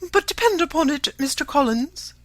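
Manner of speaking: high, loud, fussy matron; shrill-free but high and insistent mother; Mrs Bennet's voice. A narrator's reading. To Mr Collins, an eager high mother's voice.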